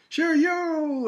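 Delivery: high, hyped yo; imitating a hype man; mock cool guy. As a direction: act the swaggering dude. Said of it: "he's like".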